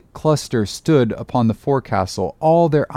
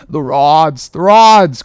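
urgent shout, rising louder; crying out; 4 semitones higher, 7 dB louder, slower than usual. A narrator's reading. Mate shouting to the crew in the storm, loud cry. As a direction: Starbuck yells a warning to the crew. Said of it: "cried Starbuck to the crew".

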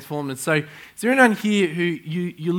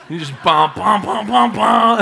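rising, louder mock "bum, bum" beats; imitated footsteps; 6 semitones higher, 7 dB louder than usual. Pastor miming friend running out, rising beats. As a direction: beat out his charge out the door. Acting out the rush out.

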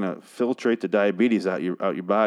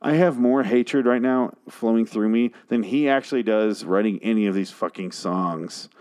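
normal talking voice

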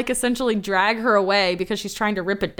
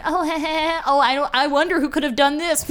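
higher-pitched fake giggly innocence; mock innocence; 7 semitones higher than usual. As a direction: play dumb with a put-on chuckle. Mocking fake-innocent retelling of culprits.

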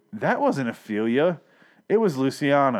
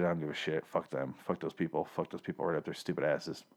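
muttered, much softer and lower; low careless throwaway curse; uncaring dismissal; 7 semitones lower, 12 dB quieter than usual. Retold or imitated. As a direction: shrug them off like they never mattered. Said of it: "He's like".

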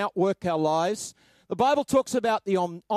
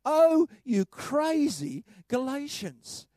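higher, slow, exasperated; slow, raised, mock-despairing scold; exasperated reading; 5 semitones higher, slower than usual. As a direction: draw it out like a fed-up teacher. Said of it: "captures Paul's frustration".

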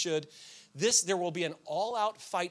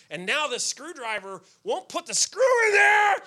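high, loud, fed-up shout at the screwdriver; a pastor reliving fury at a stubborn screw; exasperated outburst; 9 semitones higher, 8 dB louder than usual. Retold or imitated. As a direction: let the frustration burst out on screwdriver. Tool won't work, frustration boiling.

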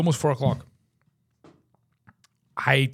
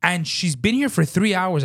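high, worked-up retold complaint; exasperated retold scolding; indignant rant; 5 semitones higher than usual. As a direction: pile on the outrage.

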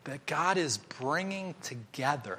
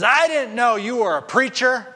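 high, loud startled accusation; higher, louder astonished man; agitated counter man; 11 semitones higher, 11 dB louder than usual. Retold or imitated. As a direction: blurt it out, caught off guard.

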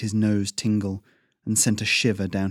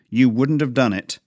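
louder, harsher finish of the rebuke; angry growl; 4 semitones higher, 6 dB louder than usual. Acted. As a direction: land the rebuke hard. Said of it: "the man growled".

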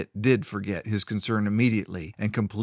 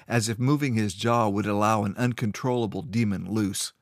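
ordinary delivery, nothing marked

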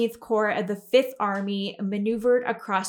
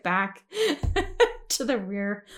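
laughing; 4 semitones higher, 5 dB louder than usual. Real, not acted. Laughing through the funny story.

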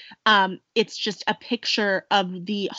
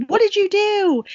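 alarmed shout; 11 semitones higher, 5 dB louder than usual. Retold or imitated. Abigail alarmed at Ben.

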